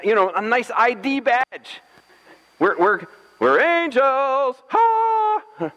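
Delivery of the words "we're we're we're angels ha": higher, slow and grand, ending in a high squeal; hammed-up proclamation of being angels; mock angel announcement. Strangers mock-announcing they are angels.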